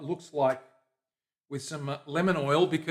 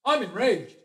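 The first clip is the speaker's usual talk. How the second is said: a hint of playful mock rage on "I'm enraged", higher and louder; mock enraged mantra; 7 semitones higher, 6 dB louder, slower than usual. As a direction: toss out "I'm enraged" like a jokey battle cry. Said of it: "That's his mantra now".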